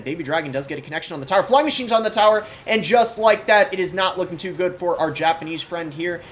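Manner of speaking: high, loud surge of play-by-play hype; excited casting. Real, not acted. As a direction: call the winning attack like an esports caster. Excited live call of winning attack.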